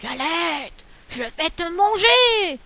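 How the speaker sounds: lightly voiced wolf, slow menace; a hint of a hungry wolf; wolf threatening the galette, lightly voiced. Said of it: "dit le loup".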